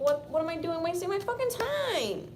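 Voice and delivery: high, loud, mock-frustrated wail; exasperated outburst. Fed-up giver questioning the wasted effort, exasperated. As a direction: cry out fed up, stretching the end high.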